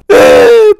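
high, loud squeal of delight; exaggerated cartoon whoop; excited squeal. Hears there is free cake.

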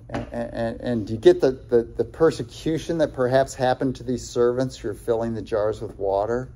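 usual speaking voice

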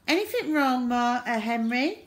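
put-on high, lilting girl's question; mock sweetness. A narrator's reading. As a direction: ask with sugary innocence. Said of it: "asked Margaret sweetly".

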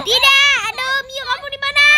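high, frantic cries for help; panicked shriek. Acted. Panicking at Grandpa Butler to help them, high frantic cries. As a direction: squeal in panic as if burned.

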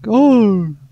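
loud high frustrated scream; rage scream. Real, not acted. Gamer screams as the level beats him. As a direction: scream out in fury. Raging at the game.

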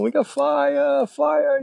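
slow, high-pitched mock yell; retold yell. The man at the store front, slow high mock yell warning the crew. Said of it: "He's like yelling".

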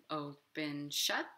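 ordinary delivery, nothing marked

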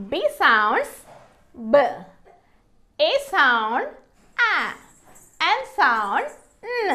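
"B", "A", "N" as isolated sounds; swooping "sounds", then the bare phonic after a pause; phonics sounding-out. Shown as a demonstration. Teacher sounding out B, A, N for learners, each sound separate. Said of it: "ఎలా పలుకుతాయో చూద్దాము".

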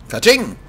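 high, loud "Katsching" crash; a high, loud mock glass-shattering sound; glass smash noise. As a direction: make the window shatter with your voice. Breaking the window glass.

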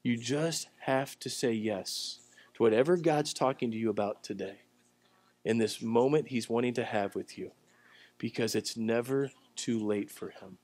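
low and soft, intimate plea; soft repeated appeal. Real, not acted. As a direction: drop down softly and press it home. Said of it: "Let me say that to you again".

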